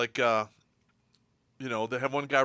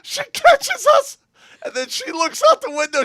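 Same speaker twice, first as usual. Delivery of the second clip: laughing; 12 dB louder than usual. Real, not acted. Laughing while telling story.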